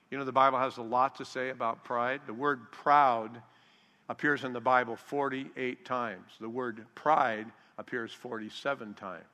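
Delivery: ordinary delivery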